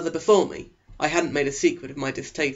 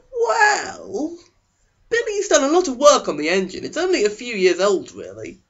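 evasive dad; 9 semitones higher, 5 dB louder than usual. A narrator's reading. Dad evades question about truck's age.

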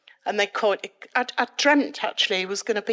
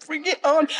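higher, louder triumphant cheer; retold cheering; 7 semitones higher, 6 dB louder than usual. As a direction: cheer them on from the sidelines.

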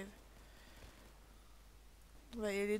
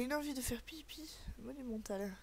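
hushed aside under the breath; whispering; 8 dB quieter than usual. Real, not acted. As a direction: whisper it to yourself. Urgent need to pee aside.

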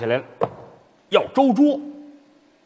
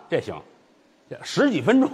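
ordinary talk

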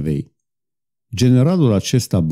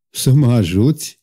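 bitter laugh; 4 semitones higher than usual. A narrator's reading. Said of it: "râzând amarnic".

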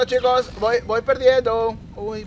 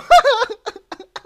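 1 high laugh; 9 dB louder than usual. Real, not acted. Laughs at tense game.

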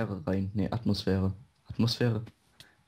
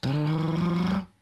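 spinning top whirr; 7 semitones higher than usual. Pretend. Imitates spinning noise.

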